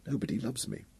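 put-on soft, hurt child's voice; a hint of a sulking girl about to cry; girl telling her uncle nobody loves her; trembling lip. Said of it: "her lower lip trembling".

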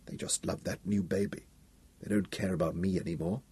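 put-on quiet sulky child complaining; girl complaining about the new baby, softly hurt; sad girl. Wanda's sad complaint voiced.

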